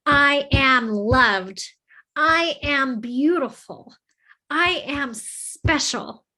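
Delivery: drawn-out, emotional self-affirmation; with emotion and conviction. Shown as a demonstration. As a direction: declare each word slowly and strongly, with heartfelt conviction. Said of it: "you're gonna say it with emotion".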